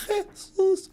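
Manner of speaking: high, soft, effortful "Jesus."; boy's first word. Retold or imitated. Formerly deaf boy saying his first word, high and hesitant. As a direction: struggle the word out high like a child's first try.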